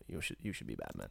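a deep confiding director; Christopher Nolan impression. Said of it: "Christopher Nolan's like".

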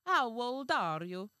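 put-on loud, slow, high Italian-accented question. Servant asking the woman's age, loud Italian accent.

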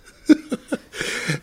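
high breathy burst of laughter; real laugh at the memory. Real, not acted. Laughs at his own story.

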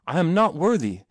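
protesting. A narrator's reading. Boy telling Achilles he is unworthy, protesting. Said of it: "he protested".

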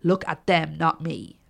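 ordinary delivery, nothing marked

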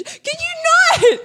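high shrieking laugh through the words, slow. Real, not acted. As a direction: squeal it out while cracking up. Laughing plea to finger-gunning cohost.

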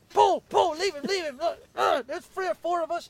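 high, loud pleading to let go; frantic plea. Retold or imitated. Pulling a mate off another man in a bar, high loud pleading. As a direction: yell at him to leave the man alone. Retold pleading to stop the fight.

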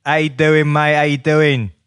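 matey put-on Cockney patter; East London accent. Bethnal Green local greeting a visitor, London accent. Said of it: "Is that what they say?".

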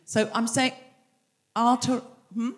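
usual speaking voice

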